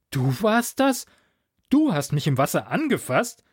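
incredulous question, starting high; stunned disbelief. A narrator's reading. Victim learning who played the prank, stunned disbelief. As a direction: ask as if you can't believe it. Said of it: "fragte ich fassungslos".

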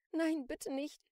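high, loud, frightened begging; pleading. A narrator's reading. Shopkeeper begs the armed Celine, pleading. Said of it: "flehte die Frau".

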